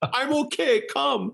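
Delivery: mock tearful squeak; very high, comically choked-up reassurance; crying. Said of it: "I'm crying".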